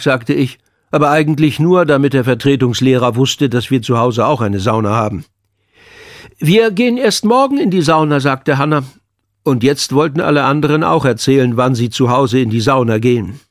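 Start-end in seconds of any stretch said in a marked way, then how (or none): 6.4-8.2 hohe, gespielte Mädchenstimme; Schülerin. A narrator's reading. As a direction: sprich hoch wie ein Schulmädchen. Schülerin Hanna wird gesprochen. (Schülerin mit heller angehobener Stimme)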